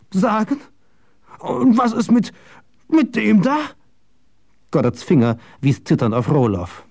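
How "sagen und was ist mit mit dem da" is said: high, agitated questioning; shocked, high-pitched old man; frantic distress. A narrator's reading. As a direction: stammer it out in rising panic. Distraught old man sees the body.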